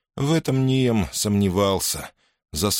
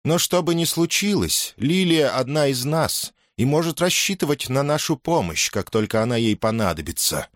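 fox woman; 7 semitones higher than usual. A narrator's reading. Fox woman pledges help to Lilia, higher voice. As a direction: start high, ease down by the end.

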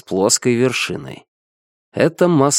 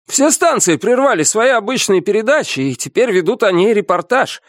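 high-pitched, urgent report; excited chattering; 10 semitones higher than usual. A narrator's reading. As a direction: rattle off the news in a high, excited voice. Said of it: "продолжал трещать первый помощник".

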